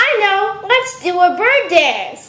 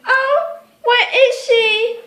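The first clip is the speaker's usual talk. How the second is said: usual speaking voice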